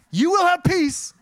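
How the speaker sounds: high, slow, sugary promise of peace; butter them up with the good part; mock sweet reassurance. Preacher mimicking Jesus's gentle opener, high and slow. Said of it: "is a compliment sandwich".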